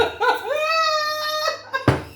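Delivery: shrieking laugh. Real, not acted. Reader cracking up at her own joke, shrieking laugh. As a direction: burst into a squealing laugh.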